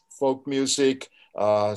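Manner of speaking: normal talking voice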